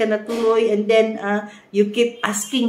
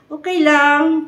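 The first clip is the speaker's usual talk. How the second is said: curt, clipped-off okay lang, louder and higher; irritated okay lang; 8 semitones higher, 8 dB louder, slower than usual. Retold or imitated. Partner brushing off the question, mock irritated. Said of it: "sounds irritated".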